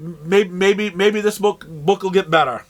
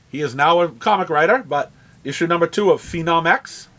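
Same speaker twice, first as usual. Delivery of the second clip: usual speaking voice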